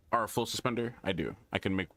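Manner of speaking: ordinary delivery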